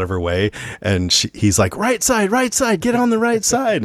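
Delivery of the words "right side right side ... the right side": high-pitched frantic passenger, played up; urgent warning. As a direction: imitate a panicked passenger, pitch way up. Panicked passenger warns wrong-side driver.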